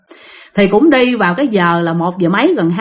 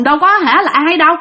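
usual speaking voice

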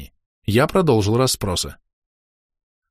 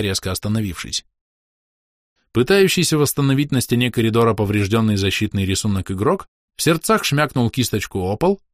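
usual speaking voice

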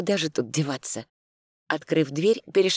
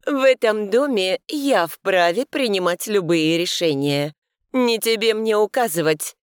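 haughty; 5 semitones higher, 4 dB louder than usual. A narrator's reading. Housekeeper asserts power.

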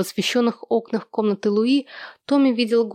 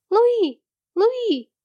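put-on very high child's call; high-pitched, held calls of a name; 12 semitones higher than usual. A narrator's reading. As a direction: hail her from afar in a little-boy voice. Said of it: "окликнул её от калитки Томми".

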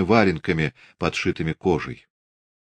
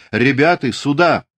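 high, raised call for backup; shout; 7 semitones higher, 4 dB louder than usual. A narrator's reading. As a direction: call the men over urgently. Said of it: "крикнул".

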